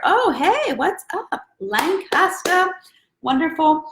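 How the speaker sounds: high, stretched hey what's up; light up and sing it out high; excited greeting. Real, not acted. Greeting a viewer from Lancaster, excited and high.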